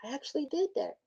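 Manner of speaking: soft, awed "I actually did that" falling on "did"; quiet amazement. Shown as a demonstration. Coach showing how to praise yourself, hushed and amazed. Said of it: "I need to say".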